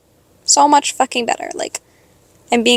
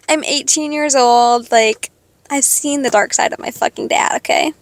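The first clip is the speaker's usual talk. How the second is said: usual speaking voice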